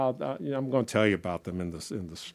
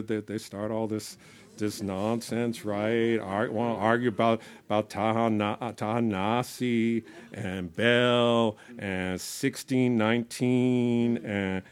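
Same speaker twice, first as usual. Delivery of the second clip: usual speaking voice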